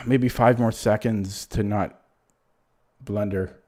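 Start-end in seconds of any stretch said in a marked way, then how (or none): none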